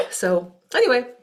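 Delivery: clearing a cough, then talking. Real, not acted. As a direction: speak right after a cough. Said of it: "sorry I'm coughing so much".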